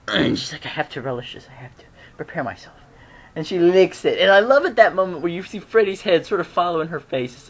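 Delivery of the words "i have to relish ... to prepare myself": low, soft, near-whispered imitation; quiet, reverent mock-Sam voice; hushed savouring. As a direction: hush it down as if savouring. Acts Sam savouring the moment.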